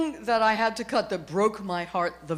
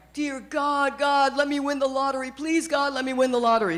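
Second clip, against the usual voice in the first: a pleading little man begging God, pitched up; pleading prayer; 5 semitones higher than usual. Retold or imitated. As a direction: beg God for the lottery, lightly. Man praying in the joke.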